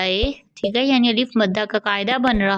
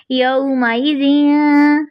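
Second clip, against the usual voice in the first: slow recited यौमाइज़िन; Quranic recitation; 3 semitones higher, much slower than usual. Shown as a demonstration. Teacher recites the word slowly for students. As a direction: chant slowly with light nasal. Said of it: "बारीक इख्फा भी होगा".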